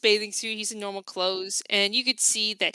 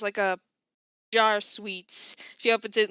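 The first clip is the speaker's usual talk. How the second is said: ordinary delivery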